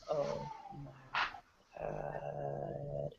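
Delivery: quiet muttered disbelief; hushed aside. Retold or imitated. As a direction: say it under your breath. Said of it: "we're just sitting there like".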